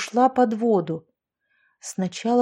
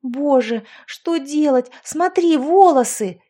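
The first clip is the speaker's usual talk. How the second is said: panicked shouting; 5 semitones higher, 5 dB louder, slower than usual. A narrator's reading. Panic in the water.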